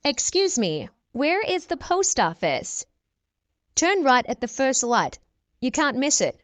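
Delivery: usual speaking voice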